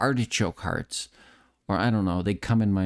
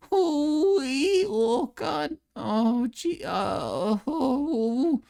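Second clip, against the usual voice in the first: very high, drawn-out cartoon voice; Morty; much slower than usual. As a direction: squeal the stammered exclamations way up high. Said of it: "You know why I do the Morty voice?".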